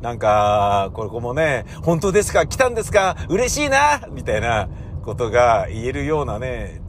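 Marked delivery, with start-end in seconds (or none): 1.8-4.1 high-pitched, hammed-up happy surprise; pure delight. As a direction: gush like an innocent believer, climbing higher. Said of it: "純粋なね、".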